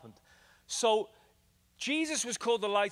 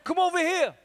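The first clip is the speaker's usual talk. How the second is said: loud, high American holler; American accent; 13 semitones higher, 8 dB louder than usual. Acted. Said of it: "That's my American accent".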